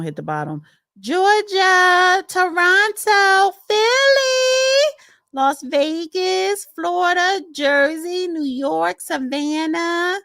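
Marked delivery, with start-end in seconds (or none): 1.0-5.0 high, loud, drawn-out city call; whooped shout-out; roll-call shout. Real, not acted. Calling out viewers' cities in roll call. 5.3-10.2 high-pitched, lilting list of shout-outs; roll-call call-out. Real, not acted. Reading off viewers' cities in roll call.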